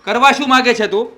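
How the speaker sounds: loud, high demand with કરવા hit hard; angry shout. Acted. Husband demanding answer from wife, angry shout. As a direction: yell the question at her. Husband repeats question, now yelling.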